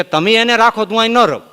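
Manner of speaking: sulking protest. Retold or imitated. The offended religion protests.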